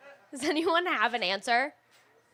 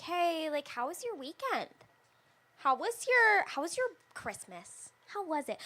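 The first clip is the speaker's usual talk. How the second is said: high, chirpy office small-talk voice; a hint of forced cheerfulness; fake polite small talk; 6 semitones higher than usual. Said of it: "you have to be like".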